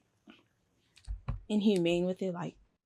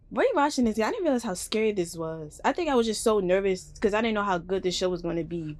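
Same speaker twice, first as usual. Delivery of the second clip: ordinary talk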